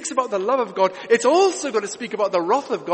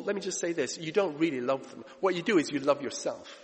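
normal talking voice

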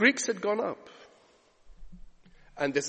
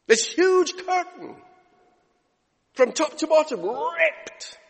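raised, higher preaching voice; preaching at full force; 5 semitones higher, 5 dB louder than usual. Acted. Preacher telling the congregation of the torn veil, rising fervour. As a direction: drive it home to the church.

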